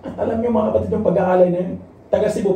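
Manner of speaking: usual speaking voice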